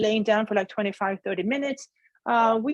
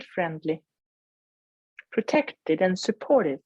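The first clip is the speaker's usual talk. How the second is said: guided meditation. Acted. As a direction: gently guide them inward.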